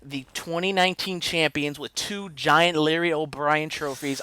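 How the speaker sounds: worked-up, drawn-out complaint; triggered rant. Real, not acted. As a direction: hit each detail with loud outrage. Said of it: "I was triggered".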